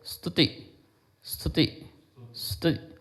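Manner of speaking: स्तुति with the स only breathed, then soft; breathy sa. Shown as a demonstration. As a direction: whisper the स of स्तुति into the next sound. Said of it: "सा को बिल्कुल हवा में ले जाओ". (स्तुति का स हवा में, धीमे)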